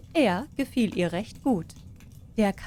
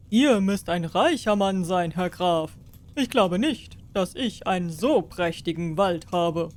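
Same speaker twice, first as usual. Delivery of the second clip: lightly voiced king. King asks the peasants about the hay, a hint regal.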